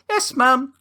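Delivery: high put-on child voice; reply like an obedient little son; little boy. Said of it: "And he's like".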